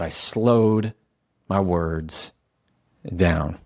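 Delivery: very slow, with long pauses between phrases; slow it right down, pausing before the last word; measured, deliberate, not yelling. Shown as a demonstration. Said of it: "I turned down the volume of my words".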